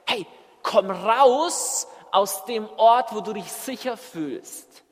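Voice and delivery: loud, drawn-out summons; calling out. Acted. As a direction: call someone out of hiding, slowly. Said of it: "Er ruft dich raus". (lautes, langgezogenes Herausrufen)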